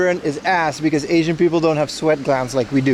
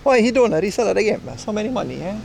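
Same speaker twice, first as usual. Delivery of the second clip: mock Italian accent, higher and quick; 4 semitones higher, faster than usual. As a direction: put on a playful Italian accent. Mocking an Italian reseller.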